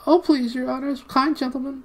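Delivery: very high, pleading little voice; whimpering gnome. A narrator's reading. Gnome begs his captors, whimpering. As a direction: whimper and beg in a squeaky pitch. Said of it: "whimpered the gnome".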